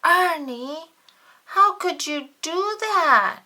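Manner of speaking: high, loud hurt cry; cried out. A narrator's reading. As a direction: cry out betrayed. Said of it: "cried Bert".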